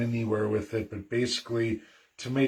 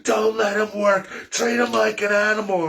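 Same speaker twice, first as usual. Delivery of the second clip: imitating the host's screaming tirade; mock furious yell; 7 semitones higher than usual. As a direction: rant like a furious radio host. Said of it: "in that rage".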